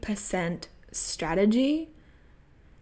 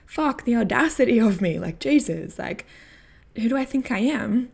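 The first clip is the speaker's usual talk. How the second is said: self-doubting exasperation; 4 semitones higher, 6 dB louder than usual. Retold or imitated. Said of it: "Or, you know".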